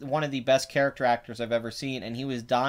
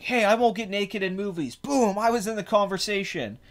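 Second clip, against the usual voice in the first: higher, lightly hammed-up reveal; pretend unmasking reveal; 7 semitones higher than usual. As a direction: play a mock big reveal, pitched up. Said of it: "He just pulls the mask off".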